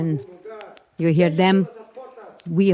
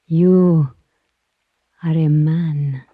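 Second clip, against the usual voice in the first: usual speaking voice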